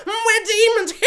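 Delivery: high, laughing little demon voice; giggly demons. Retold or imitated. Demons on Strange's body gloating while feeding, high and giggling. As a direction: cackle it like tiny gleeful demons.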